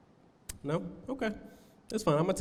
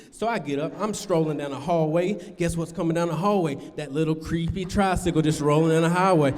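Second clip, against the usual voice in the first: ordinary talk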